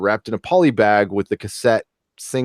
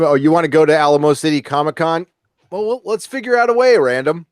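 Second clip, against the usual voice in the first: excited; 6 semitones higher, 4 dB louder than usual. Real, not acted. Excited reply to viewer.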